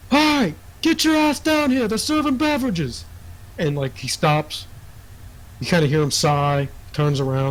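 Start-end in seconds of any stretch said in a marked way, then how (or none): 0.1-3.1 much higher call from a distance. Acted. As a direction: yell up the stairs to fetch him. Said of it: "you hear someone".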